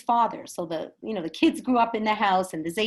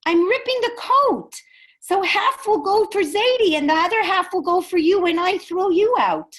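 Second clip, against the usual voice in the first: high, loud grandson confronting father; impassioned, retold child's retort; acted defiant shout; 9 semitones higher, 8 dB louder than usual. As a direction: drive the punchline home at full voice.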